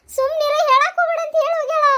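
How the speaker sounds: put-on high childish voice hushing grandma. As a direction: play the small granddaughter. Child character voice.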